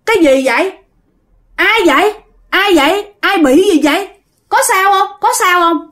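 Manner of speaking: put-on a little girl asking who is there; young girl's voice. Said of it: "giọng nói là con gái".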